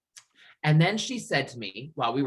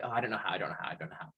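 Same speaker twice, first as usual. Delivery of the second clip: anxious mumble; 4 semitones lower, 9 dB quieter, much faster than usual. Retold or imitated. Anxious dodge, fading.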